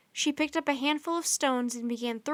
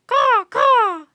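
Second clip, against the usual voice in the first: very high, loud cawing call; thankful raven caw; 11 semitones higher, 16 dB louder than usual. A narrator's reading. Raven thanking Annie as he flies, very high loud caw. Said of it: "he called, as if thanking her".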